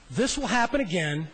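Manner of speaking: usual speaking voice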